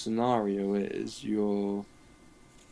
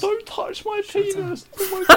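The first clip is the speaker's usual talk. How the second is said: put-on high squeaky child; 8 dB louder than usual. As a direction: squeal it like a shocked little boy. Child voice protesting, laughing.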